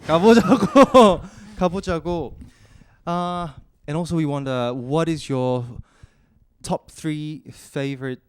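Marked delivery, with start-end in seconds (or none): 0.0-2.3 laughing. Real, not acted. Echoing the guest's Korean phrase.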